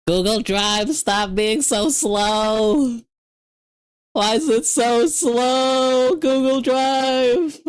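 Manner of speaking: high, slow, drawn-out whine; begging the upload to hurry; high drawn-out whining at Google Drive; whiny complaint. Real, not acted.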